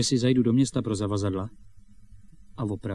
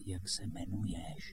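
slow, very soft aside; urgent covert question; 5 semitones lower, 15 dB quieter, slower than usual. Acted. Boy presses his friend for a name, hushed. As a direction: hiss it slowly under your breath.

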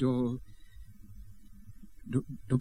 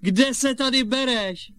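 high, drawn-out astonished question; feigned surprise; 11 semitones higher, slower than usual. Acted. Boy pretending his brother turned up unexpectedly, feigned surprise. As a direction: stretch it high as if amazed.

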